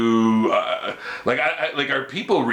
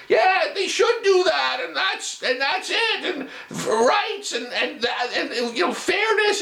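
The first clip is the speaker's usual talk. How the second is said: very high, strained caricature of outraged people; squeaky mock protester, starting with a shout; mock knee-jerk reactors. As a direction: mimic people jumping on a cause, in a silly high-pitched voice. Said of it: "when they just instantly react to".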